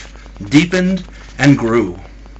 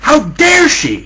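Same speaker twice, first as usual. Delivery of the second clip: high-pitched, drawn-out indignation; furious; 13 semitones higher, slower than usual. A narrator's reading. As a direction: fume in outrage, pitch jumping up. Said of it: "The husband became furious".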